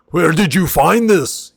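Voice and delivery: loud, thrilled outburst with a laugh in the middle; amazed half-orc delight. Acted. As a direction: gush over the cocoa like it's divine. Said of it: "he immediately is like".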